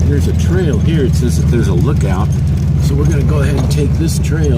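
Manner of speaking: loud over bumpy road noise; talking while driving a bumpy road. Real, not acted. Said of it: "sorry about the bumps".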